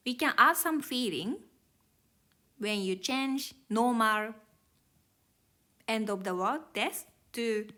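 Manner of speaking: usual speaking voice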